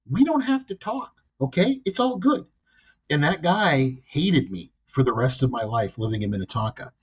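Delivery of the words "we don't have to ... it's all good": much higher, exasperated mock outburst; imitating his own snap at a talkative store clerk; retold snapping. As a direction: pitch it way up like you've finally lost patience. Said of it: "I finally snapped on this guy".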